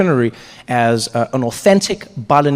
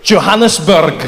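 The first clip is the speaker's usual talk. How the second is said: put-on Scottish accent; 3 dB louder, slower than usual. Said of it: "speak to people in their accent".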